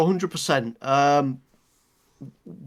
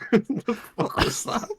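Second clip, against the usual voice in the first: higher, slow words squeezed through laughter; incredulous, laughing hard; laughing disbelief; 7 semitones higher, 3 dB quieter, slower than usual. Real, not acted. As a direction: ask it while cracking up. Laughing at co-host's accent attempt.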